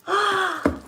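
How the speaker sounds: high gasp of surprise; delighted gasp. Real, not acted. Finding a surprise in a client's box, delighted gasp. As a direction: gasp at an unexpected present. Sees the next gift.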